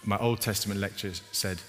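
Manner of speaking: ordinary talk, nothing marked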